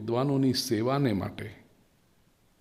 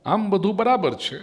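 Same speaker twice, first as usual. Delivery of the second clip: mock contentment; 9 semitones higher, 4 dB louder than usual. Shown as a demonstration. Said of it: "બોલો".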